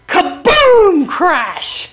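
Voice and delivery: big loud "kaboom" sound effect; explosive storyteller's crash noise; imitated crash. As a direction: boom out the noise of a car wreck. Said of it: "all of a sudden I hear".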